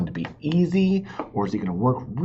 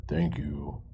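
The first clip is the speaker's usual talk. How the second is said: a lightly voiced bear, lower; bear's thanks; 9 semitones lower, 8 dB quieter than usual. The grateful bear thanks the beaver for the vase, in a lower voice. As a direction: thank him like a big bear.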